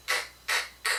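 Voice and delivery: k said with no voice, just a puff; "k" with no throat buzz; voiceless k. Shown as a demonstration. Therapist showing a parent the voiceless sound: bare k. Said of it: "So the voiceless is the K".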